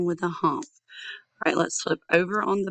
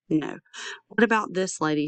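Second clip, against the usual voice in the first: usual speaking voice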